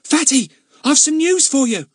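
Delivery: put-on high, loud urgent young lad; urgent boy voice. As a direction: hurry the news out like a boy. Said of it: "Ern's voice came urgently".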